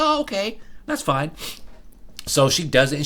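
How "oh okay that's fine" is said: sweet coworker. Retold or imitated. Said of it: "She's like".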